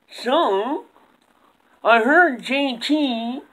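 high slow puppet coach; drawn-out puppet coach voice. Acted. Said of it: "I invited, uh, J T's coach on the show".

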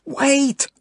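very high, desperate shout; yell. A narrator's reading. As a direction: cry out to stop them leaving. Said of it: "she yells".

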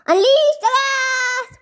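high, drawn-out battle shout; shouted command. Acted. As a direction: yell the order, stretching it out. Commander orders gas attack.

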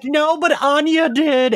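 high, slow, mock-weepy reply; a retold, half-hearted tearful whimper; tearful retold answer. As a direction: choke out the news as if still crying. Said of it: "I answered the phone crying".

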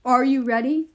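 loud booming question; giant king's yell. A narrator's reading. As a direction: call out loudly as a giant king. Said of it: "the Giant King yelled".

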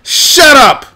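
sudden outburst of real anger; angry shout. Real, not acted. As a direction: yell it out, furious. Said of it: "Sorry, I'm, I'm angry".

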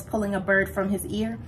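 ordinary delivery

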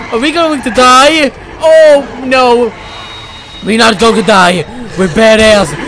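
ordinary talk, nothing marked

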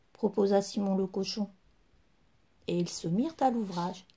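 plain reading voice

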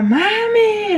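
high-pitched mock child calling Mami; imitating a child's cry. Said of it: "like, gritando".

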